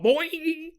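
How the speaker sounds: high springy boing noise; cartoon boing. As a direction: imitate a spring popping up. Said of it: "He's like".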